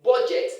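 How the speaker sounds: budget as "BOH-jet"; mispronunciation. Shown as a demonstration. Teacher shows the word that does not exist, mispronunciation. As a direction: round the first vowel of "budget" to "bo". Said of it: "no word like".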